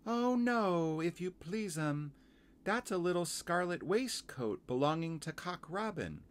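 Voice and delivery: high, polite little laundress; Missus Tiggy Winkle. Acted. Washerwoman correcting young visitor, high and polite. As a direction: lift it high, deferential and explaining.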